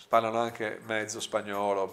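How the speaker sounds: plain reading voice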